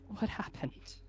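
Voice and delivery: soft, dazed whisper. Acted. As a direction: ask in a stunned haze. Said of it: "he says very dazed".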